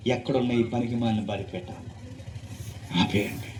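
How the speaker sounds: low, soft acted command; hushed royal instruction; subdued order. King's order, first said low.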